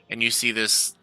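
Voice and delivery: ordinary delivery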